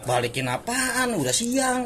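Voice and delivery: high-pitched retort, slow at first; mock objection. Friend refuses to turn back this late, objecting. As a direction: act out balking at the idea, pitch way up.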